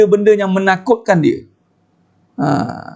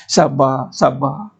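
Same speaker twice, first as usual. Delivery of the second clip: low, softening stock comfort; mock consoling; 6 semitones lower, 4 dB quieter, much slower than usual. Playing on the word sabar to the sad, mock consoling. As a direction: imitate a pat, soothing reply. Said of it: "Kena pula yang mainkan".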